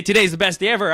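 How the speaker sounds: high, loud and fired up; pumped declaration. Retold or imitated. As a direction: shout it to start the day. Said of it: "So I say".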